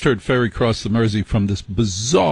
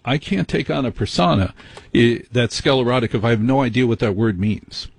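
ordinary delivery

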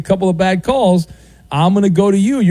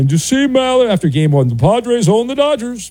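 put-on fan voice climbing higher; gloating Padres fan; 5 semitones higher than usual. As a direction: read it as a crowing sports fan. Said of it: "email from my friends in San Diego".

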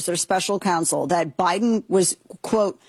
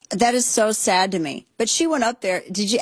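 ordinary delivery

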